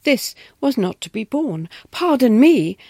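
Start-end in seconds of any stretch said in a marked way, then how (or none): 1.9-2.8 firm, louder challenge; staunch. A narrator's reading. Governess confronting the intruder, staunch and loud. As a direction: stand your ground in one phrase. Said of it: "as staunchly as I could".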